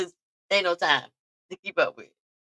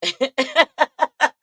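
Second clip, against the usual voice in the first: amused laugh; 8 semitones higher, 6 dB louder than usual. Real, not acted. Laughing at start of vlog.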